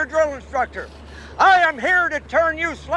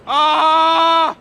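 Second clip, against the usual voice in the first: weak battle cry; 4 dB louder than usual. Acted. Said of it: "I am not impressed".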